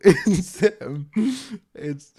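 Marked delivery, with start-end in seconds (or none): 0.0-0.6 short nervous giggle; nervous laugh. Real, not acted. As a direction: laugh nervously, half excited. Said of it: "that's a nervous laugh".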